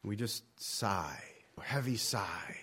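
slow breathy whisper, almost no voice. Acted. Preacher whispering to his congregation about heavy sighs. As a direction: let it drop to a hush like a sigh. Describing wordless sighing prayer.

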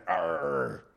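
low mock pirate arr; [name]'s lightly hammed-up roar back; pirate growl. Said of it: "He's got pirate in him".

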